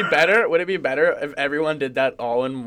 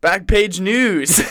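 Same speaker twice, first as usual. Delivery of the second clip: announcer voice; 5 semitones higher, 6 dB louder, much slower than usual. Acted. Show title announced.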